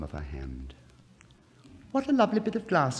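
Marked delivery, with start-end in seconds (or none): 1.9-3.0 put-on high, fast, gleeful child voice; little girl's delighted cry. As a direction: squeal it with joy. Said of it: "cried the little girl".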